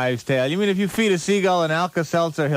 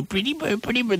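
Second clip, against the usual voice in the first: higher sing-song pretty bird; parrot voice; 6 semitones higher than usual. Retold or imitated. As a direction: coo it like talking to a parakeet. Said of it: "you go".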